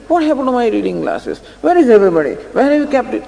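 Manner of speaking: half-played grumpy husband yelling; mock angry shouting. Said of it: "starts shouting and screaming".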